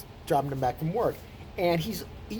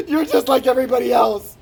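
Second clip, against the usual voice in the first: loud, high mock outburst; imitated hurt yell; 7 semitones higher, 10 dB louder than usual. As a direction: yell it like a betrayed friend. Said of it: "The only time he's ever yelled at me".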